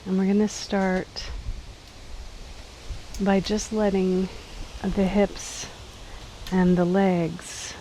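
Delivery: calm, unhurried body-scan voice with long pauses; slightly softened guiding voice, leaving space between phrases; guided meditation. Acted. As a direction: gently lead them into letting go, phrase by phrase. Leads a seated body scan.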